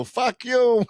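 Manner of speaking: high, playful mock yell; mock fan heckle. Vegas fans heckling a Patriots fan, high playful mock yell. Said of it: "they go".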